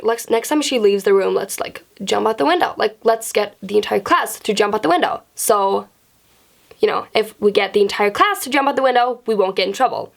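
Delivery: usual speaking voice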